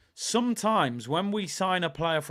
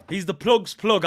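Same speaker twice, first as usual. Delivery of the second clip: louder, punching plug's plug; proud shout; 4 semitones higher, 10 dB louder than usual. Real, not acted. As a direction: announce it like a catchphrase. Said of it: "I like that one".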